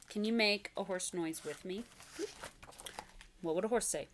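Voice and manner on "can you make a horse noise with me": ordinary delivery, nothing marked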